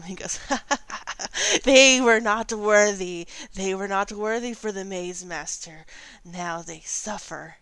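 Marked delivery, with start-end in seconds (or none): 0.4-1.6 high unhinged gnome cackle; mad cackle. Acted. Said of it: "he's cackling madly under them". 1.6-7.6 mad scientist. Acted. Cackling gnome speaks to party.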